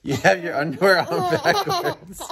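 laughing through the words; giggling while being tickled. Real, not acted. Dad laughing while kids tickle him.

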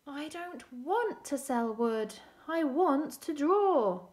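slow, loud, insistent declaration; emphatic wish. Acted. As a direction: declare it slowly, leaning hard on each wish. Character's frustrated wish in story.

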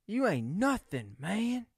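a hint of Gary Bertier; lightly voiced tough football player; quoting Remember the Titans, a touch of the character; movie quote impression. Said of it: "what did he say off of, Remember the Titans".

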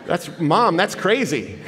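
breathless laugh through the words; laughing in disbelief. Real, not acted. Telling the couple it's crazy, laughing in disbelief. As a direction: laugh out the shock of her confession. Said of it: "a strange laugh".

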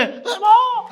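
high squeaky mock of the preacher; mocking mimicry. Mimicking the preacher to mock her, high squeak. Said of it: "mimicry చేస్తూ".

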